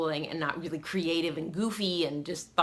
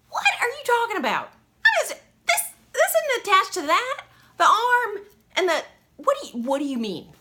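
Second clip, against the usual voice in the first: much higher, louder, fast disbelief; incredulous; 7 dB louder than usual. Retold or imitated. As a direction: squeal it in total disbelief. Her baffled retold reply.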